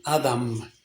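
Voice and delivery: "Adam" said like the name, falling away softly; low, soft "Adam" with no accented ending. Shown as a demonstration. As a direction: say "Adam" as a plain first name, dropping at the end. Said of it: "así como el nombre".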